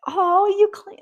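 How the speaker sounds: high, loud delighted squeal; playful surprise. Shown as a demonstration. Thanking her husband for cleaning, a high, loud delighted squeal. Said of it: "in a playful, fun, young energy".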